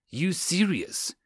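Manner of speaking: high, slow, incredulous question; disbelieving. Acted. Sergeant to wide-eyed lieutenant, disbelieving. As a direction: ask it in quiet disbelief.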